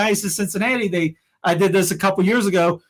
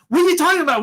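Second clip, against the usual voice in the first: exasperated scoff; exasperated outburst; 9 semitones higher, much faster than usual. Real, not acted. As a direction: throw up your hands in disbelief. Exasperated rant at movement.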